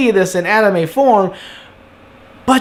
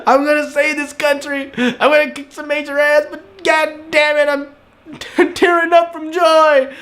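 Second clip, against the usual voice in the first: high-pitched, hammed-up tearful voice; imitated happy sobbing, pitched up high; mock crying for joy; 7 semitones higher than usual. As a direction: wail it as if tearing up from joy. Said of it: "he's still crying the whole way through".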